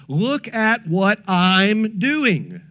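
very slow and deliberate; slow pointed aside. Real, not acted. Preacher pointing the church to his own example, very slowly. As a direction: spell it out slowly.

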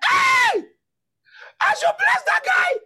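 very high, shouted disbelief; mock outrage. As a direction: cry out in mock indignation. Mock outrage at blessing enemy.

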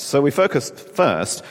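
ordinary talk

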